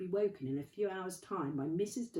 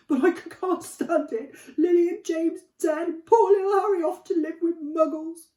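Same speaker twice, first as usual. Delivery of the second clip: much higher and louder, crying out each word; sobbing wail; 13 semitones higher, 15 dB louder than usual. Acted. Hagrid crying over Harry's dead parents, a high loud wail. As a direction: wail it through big sobs. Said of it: "sobbed Hagrid".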